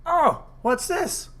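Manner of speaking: high, slow, drawn-out wonder; hammed-up innocent discovery; mock surprise. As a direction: stretch it out, feigning innocence. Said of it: "And I went".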